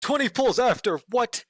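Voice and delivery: high, loud, startled; can't believe the luck; shocked disbelief. Real, not acted. Streamer reacts to a viewer's lucky pulls with shocked disbelief.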